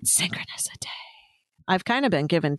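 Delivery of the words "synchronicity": low, whispery segment title; mock-mysterious murmur; hushed segment intro. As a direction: announce the segment in a spooky hush. Jokey segment name intro.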